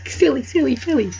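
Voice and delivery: very high teasing repeat; squeaky mock echo; mocking singsong. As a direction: tease the word up high.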